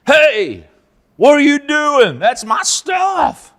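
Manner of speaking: rising, loud, slowing shout of protest; angry shout. Acted. Micah yells at the children of Dan, an outraged loud shout. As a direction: yell after the thieves to stop. Micah yelling after the Danites.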